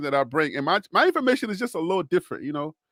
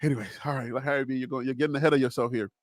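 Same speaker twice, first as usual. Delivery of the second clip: low, soft talk to himself; self-checking aside; 5 semitones lower, 6 dB quieter, faster than usual. Real, not acted. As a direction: drop down and rein yourself in. Reins himself in after outburst.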